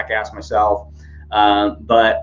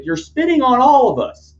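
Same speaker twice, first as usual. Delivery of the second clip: high, loud mock complaint; imitated yelling; 5 dB louder than usual. Protester scolding the unmasked yeller, high loud mock yell. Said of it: "So he's yelling at the guy".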